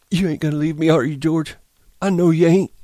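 high, loud, anxious pleading; pleading Lenny. A narrator's reading. Lenny begging George to stay, high pleading.

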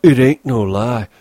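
ordinary delivery, nothing marked

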